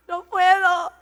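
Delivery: helpless laughing sob; can't stop laughing through tears; crying laugh. Real, not acted.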